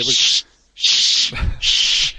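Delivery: loud, voiceless hushing sounds; playful shushing. Pretend. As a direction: shush him, half joking. Joking, hushing talk of Celtic losing.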